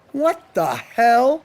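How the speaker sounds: slow, drawn-out disbelief; wondering aloud, slowly; bewildered wondering. A narrator's reading. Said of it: "he wondered".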